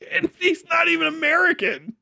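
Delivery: high cracking laugh-yell; laughing outburst. Real, not acted. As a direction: blurt it through laughter, disbelieving.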